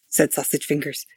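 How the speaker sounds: ordinary talk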